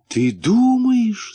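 high, drawn-out hopeful question; glimmer of hope. A narrator's reading. Муфта asks his comforting friend, tentatively hopeful. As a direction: lift it high and slow, daring to hope. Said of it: "спросил муфта с проблеском надежды".

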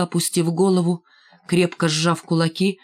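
usual speaking voice

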